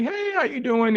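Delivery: very high, sing-song "hey, how you doing"; high eager greeting. Retold or imitated. Greeting the woman at the gym, eager high voice. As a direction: pitch way up, trying to be smooth.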